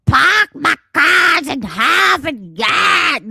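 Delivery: loud, slow Boston shout of "Park my cars in Harvard Yard" without r's; mock Boston accent. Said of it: "That's not how you say it".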